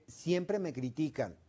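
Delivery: usual speaking voice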